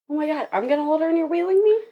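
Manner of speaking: high, quick incredulous question; retold alarm. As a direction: replay your disbelief, pitch climbing. Retelling her alarm at wheelchair ride.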